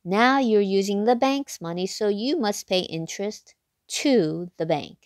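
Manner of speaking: usual speaking voice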